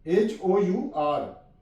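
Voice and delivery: usual speaking voice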